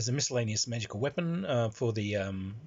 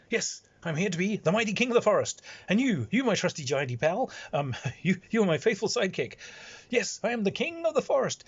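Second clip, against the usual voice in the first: grandiose self-crowned king; 8 semitones higher than usual. Retold or imitated. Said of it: "the giant says".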